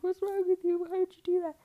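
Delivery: high, loud mock-hysterical shouting; hammed-up hysterical yelling; going crazy. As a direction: act out a frantic, outraged outburst. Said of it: "going crazy".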